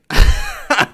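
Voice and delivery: a sudden high-pitched laugh; burst of laughter. Real, not acted. Reader cracks up at a familiar scene, laughing high. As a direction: laugh out loud, high and sharp. Reacting to the manga panel.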